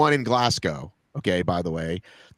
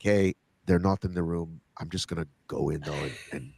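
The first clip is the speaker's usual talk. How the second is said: low, quiet mock-Ukrainian accent; bad Ukrainian accent; 8 semitones lower, 7 dB quieter than usual. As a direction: put on a clumsy Ukrainian accent, dropped low and soft. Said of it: "I'm gonna do a bad Ukrainian accent now".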